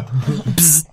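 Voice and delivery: short buzzing zap; vocal sound effect of a zap; electric zap noise. Acted. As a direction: imitate a small electric shock. Imitates the droid's electric shock.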